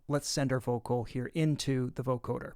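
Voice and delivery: normal talking voice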